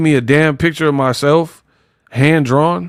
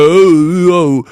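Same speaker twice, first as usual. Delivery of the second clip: louder, garbled mock host welcome; put-on dopey babble; 3 semitones higher, 4 dB louder than usual. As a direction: bellow nonsense in an exaggerated silly voice. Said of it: "I don't just be like".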